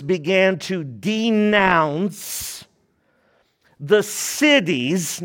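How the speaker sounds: slow, deliberate scripture reading; read slowly. Shown as a demonstration. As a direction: draw each word out for the listeners. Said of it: "Let me read this slowly".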